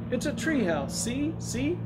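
put-on high, rising excited boy voice; excited child. A narrator's reading. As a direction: point it out eagerly, pitch leaping up. Boy excitedly calling up to treehouse.

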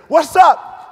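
high, full shout of greeting; big, loud American greeting; loud obnoxious American. Acted. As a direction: holler it like a brash American. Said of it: "are very loud and obnoxious".

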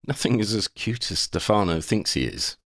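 usual speaking voice

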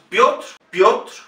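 Piotr with I and O fused into "yo". Shown as a demonstration. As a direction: repeat Piotr crisply as one "Pyotr" syllable. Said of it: "se pronuncia yo".

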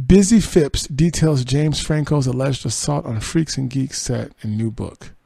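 slow, announced news headline; headline read. Acted. Host announcing the next celebrity story, headline read.

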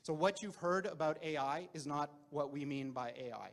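usual speaking voice

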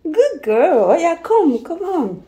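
high, bright praise; cheerful praise. Real, not acted. Mother praising daughter for agreeing to brush, high and cheery. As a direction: reward her warmly and lift the pitch.

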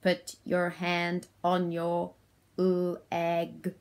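slow monotone robot instruction. Shown as a demonstration. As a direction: order like a robot, splitting LEG into l and egg. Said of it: "in my robot voice".

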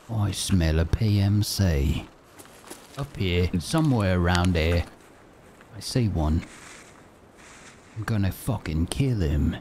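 hammy Aussie gamer voice; mock Australian accent. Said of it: "I'll stop talking like that".

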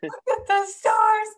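high, loud, slow outraged echo; retold fury. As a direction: throw his words back, drawn out and furious. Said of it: "I was so mad".

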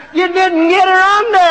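imitating the preacher's high, loud bellow; retold bellow. The other preacher bellowing at him from the bank, retold as a high, loud bellow. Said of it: "The preacher's down there bellowing".

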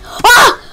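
short, high yelp of fright; sudden sharp "ah" of shock; startled yelp. Real, not acted. Player startled by a spider, frightened yelp.

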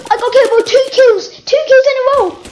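high, loud game-death outcry; worked-up, high-pitched yelling; dismayed outcry. Real, not acted. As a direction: cry out in dismay at getting killed. Killed in the game.

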